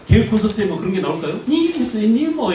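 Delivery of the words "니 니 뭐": high-pitched mimicry of Gyeongsang dialect; 양상국's Gyeongsang dialect. Lecturer recalls a comedy show sketch, high mock Gyeongsang accent. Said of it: "경상도에서 올라온 양상국인가?".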